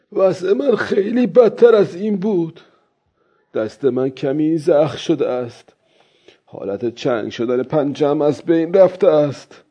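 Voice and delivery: put-on high-pitched old man reassuring himself; old fisherman. Old man checking his hand and legs, character voice. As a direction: take stock aloud in the fisherman's voice.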